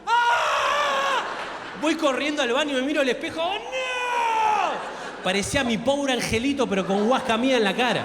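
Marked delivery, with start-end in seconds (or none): 0.1-1.2 very high shocked scream. Retold or imitated. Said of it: "Empecé a gritar solo en mi cuarto". 3.4-5.2 very high shocked "oh no"; horrified cry. Retold or imitated. Sees himself in mirror.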